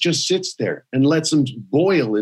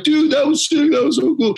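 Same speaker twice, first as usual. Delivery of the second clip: high, excited bro voice; imitating stoked onlookers; hyped-up crowd; 12 semitones higher than usual. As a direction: gush like amazed fans.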